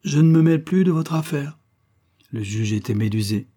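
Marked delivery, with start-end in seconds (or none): 0.0-1.6 firm, louder and higher ultimatum; a touch of deliberate insistence; deliberately. Acted. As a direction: lay down the condition, word by word. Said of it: "acheva-t-il délibérément".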